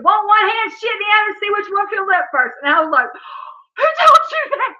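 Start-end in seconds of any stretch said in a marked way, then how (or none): 0.0-2.6 put-on child's voice. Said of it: "And she said". 3.1-4.7 gasp, then shriek the question; shocked gasp. Retold or imitated. Shocked mom retelling reaction.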